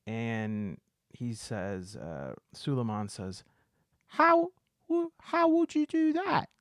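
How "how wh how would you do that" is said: very high-pitched British character voice; British accent. Acted. Beggar questioning his captors' threat, British accent. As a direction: put on a high, squeaky British voice. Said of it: "is British".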